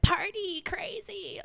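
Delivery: high, drawn-out whoop; whooping party voice. Shown as a demonstration. Sings out the kind of crazy.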